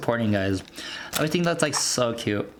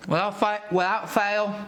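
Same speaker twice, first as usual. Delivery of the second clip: put-on a light British accent, pitched up; 5 semitones higher than usual. Tasting food on camera, in a mock British accent. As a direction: slip into a playful British accent. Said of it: "dived into that accent. It's like a British".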